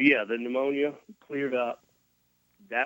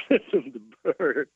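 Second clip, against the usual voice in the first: higher pitch, breaking into laughter; laughing punchline; 4 semitones higher than usual. Real, not acted. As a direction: laugh your way through the punchline. Laughing at his own punchline.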